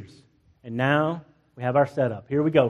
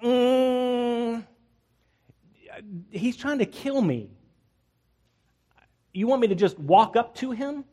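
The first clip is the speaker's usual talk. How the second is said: nervous Elijah; 5 semitones higher, slower than usual. Pretend. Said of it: "Elijah was like".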